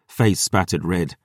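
ordinary delivery

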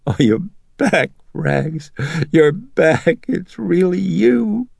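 a mother overjoyed her daughter is back; happy tears. A narrator's reading. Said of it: "Chris herself became tearful, but with happiness".

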